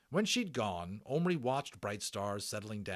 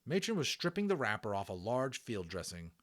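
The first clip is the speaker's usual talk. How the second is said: usual speaking voice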